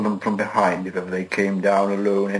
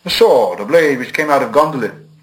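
bold answer; 4 semitones higher, 5 dB louder than usual. A narrator's reading. Bilbo answers boldly.

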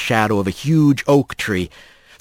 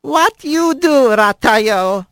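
higher, slow grandmother voice in broken speech; old squirrel grandmother; 9 semitones higher, much slower than usual. Acted. Grandmother greeting her grandson, higher and slow. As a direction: coax him like a fond old granny. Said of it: "she asked in Manx".

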